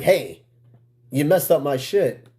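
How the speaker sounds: ordinary delivery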